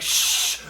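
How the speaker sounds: sharp "shh" hush; acted shushing. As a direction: shush visitors in mock alarm. [name] hushes her visitors.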